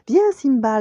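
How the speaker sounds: high, loud call to a friend. A narrator's reading. Nala inviting Simba to play, calling out. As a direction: call out brightly from a distance. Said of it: "l'appela-t-elle".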